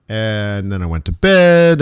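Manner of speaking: normal talking voice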